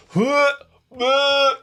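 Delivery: short high-pitched "huh, uh" noises; imitated noise. Host re-enacting the noise they made, high imitated grunts. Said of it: "me going like".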